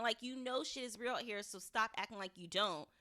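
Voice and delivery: normal talking voice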